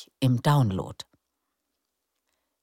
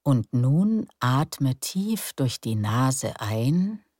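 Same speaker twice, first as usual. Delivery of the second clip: guided meditation. Acted. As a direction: lull them into calm breathing. Leads breathing relaxation. (ruhige Meditationsstimme)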